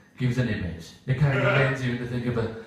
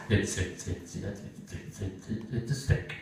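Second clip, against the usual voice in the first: much softer, whispered terrified stutter; nervous stammer; 6 semitones lower, 11 dB quieter, slower than usual. Acted. Kevin caught with a stick, whispered frightened stammer.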